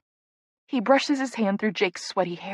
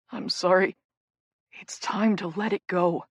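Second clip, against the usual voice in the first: murmured. Acted. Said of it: "Caleb murmurs".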